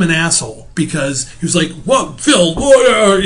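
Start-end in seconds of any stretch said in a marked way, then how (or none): 1.8-3.2 higher, louder indignant "What?"; offended outburst. Retold or imitated. Neighbor offended at being called a bureaucrat, indignant. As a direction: act out the neighbor taking offense. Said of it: "he got all pissed off".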